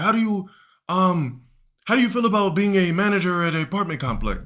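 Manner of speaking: a high, hopeful pitch to the student; eager teacher offering a job. Retold or imitated.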